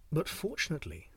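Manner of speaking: ordinary delivery, nothing marked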